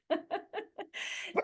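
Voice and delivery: high, light giggle; amused laugh. Real, not acted. Coach amused by her own toothbrushing joke, high giggle.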